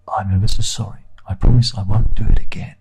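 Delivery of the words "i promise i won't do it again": close-mic whisper; ASMR whisper. Pretend. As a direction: breathe it right into the mic. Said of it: "imagine I'm whispering in your ear".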